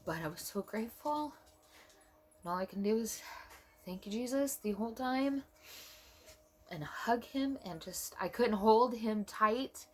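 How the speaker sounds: high, tearful, choked-up voice; fighting tears. Real, not acted. Mother recalling holding her newborn, fighting tears. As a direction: push through the tears, pitch climbing. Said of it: "I'm trying not to cry".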